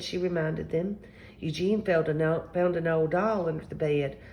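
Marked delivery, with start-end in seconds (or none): none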